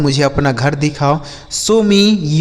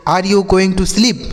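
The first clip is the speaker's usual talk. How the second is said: slow, careful English question; slow model reading; 3 semitones higher, much slower than usual. Shown as a demonstration. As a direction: read it out slowly for learners. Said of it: "slow model sentence".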